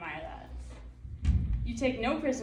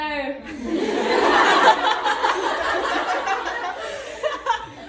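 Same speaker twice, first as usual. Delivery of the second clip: long, loud burst of laughter; cracking up at the memory; 8 semitones higher, 9 dB louder than usual. Real, not acted. Teammate cracking up over the toilet story, loud laugh. Big laugh after quote.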